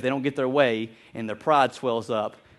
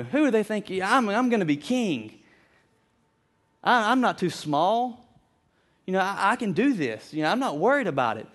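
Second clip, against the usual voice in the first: put-on young Simba bragging; 6 semitones higher than usual. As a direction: puff up like a proud young lion. Young Simba boasting to friends.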